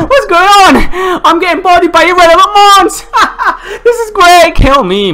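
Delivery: very high, half-laughing, half-crying outburst; cracking up in high-pitched despair; hysterical disbelief. Real, not acted. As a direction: squeal through desperate laughter. Losing monsters to confusion.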